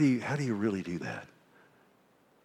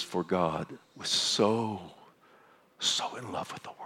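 hushed, lingering on so; 3 semitones lower, 5 dB quieter than usual. Shown as a demonstration. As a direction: lean on so and let it drop to a breath. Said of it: "He says".

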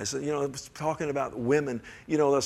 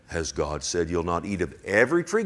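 a much lower mock of the serpent's line; 7 semitones lower than usual. Preacher mimicking the serpent's twisted question, low. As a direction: sneer it low.

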